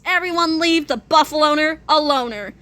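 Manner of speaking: usual speaking voice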